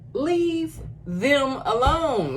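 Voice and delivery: high, drawn-out protest; exasperated plea. Real, not acted. Reactor objecting to people netting a rare fish, exasperated plea. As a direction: stretch it out slowly, pitched high, pleading. Protesting how humans treat animals.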